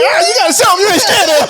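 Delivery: high, fast, hammed-up bossy mom; mocking mom. Friend mocking the mom's dog advice, high and fast. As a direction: parrot her advice in a mock high voice.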